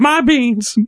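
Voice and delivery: squeaky elf voice. Pretend. Alfie voice continues.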